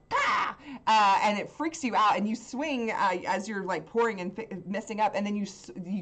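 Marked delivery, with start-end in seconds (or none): 0.1-0.5 loud, high imitated crow caw. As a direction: let out a sharp bird caw. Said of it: "you hear one of the birds, like".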